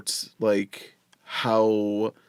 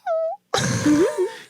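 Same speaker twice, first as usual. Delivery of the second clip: layman's kitten meow. Shown as a demonstration. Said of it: "For reference, this is a layman".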